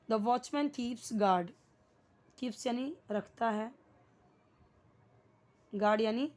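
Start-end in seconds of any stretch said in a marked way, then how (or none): 0.1-1.5 slow model sentence. Shown as a demonstration. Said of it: "okay".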